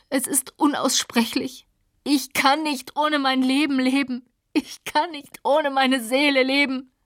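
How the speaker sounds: high, desperate begging in grief; anguished pleading. Acted. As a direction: plead with a breaking heart to the dead. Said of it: "Anfall unbezähmbarer Leidenschaft".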